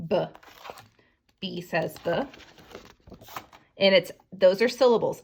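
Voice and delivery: ordinary talk, nothing marked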